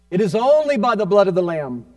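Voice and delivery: loud, ringing declaration; forceful preaching. Real, not acted. Preacher proclaiming the blood of the Lamb, loud and forceful. Repeats line with more force.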